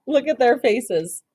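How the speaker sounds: ordinary delivery, nothing marked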